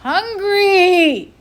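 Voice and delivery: high, loud wail. A narrator's reading. The child wails in the dark to scare the boys, loud high wail. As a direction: wail it out like a starving ghost. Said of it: "you wailed again".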